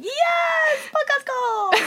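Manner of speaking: high mock shout of joy; imitating excited fans. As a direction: cheer like a thrilled listener. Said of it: "they're like".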